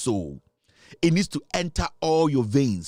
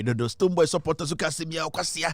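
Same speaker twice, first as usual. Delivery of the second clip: ordinary delivery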